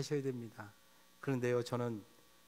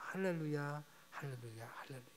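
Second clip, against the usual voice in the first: each softer, trailing away; fading hallelujah; 9 dB quieter than usual. Real, not acted. Preacher closing the sermon, hallelujahs fading softer. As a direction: let the hallelujahs die to a hush.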